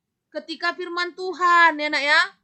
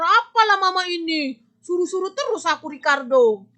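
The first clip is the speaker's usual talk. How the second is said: put-on a child rudely refusing mama's chore, complaining loudly; rude child talking back; 3 semitones higher, 5 dB louder than usual. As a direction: sulk and snap back at mama. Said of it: "Itu kasar".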